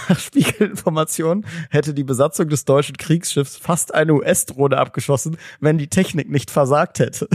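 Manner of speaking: real giggle under the read-out; amused news reading. Real, not acted. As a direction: read it out while trying not to crack up. Reads absurd news item, barely holding laughter.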